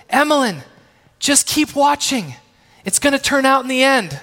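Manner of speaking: calling down reassuringly. Retold or imitated. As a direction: call down to comfort her.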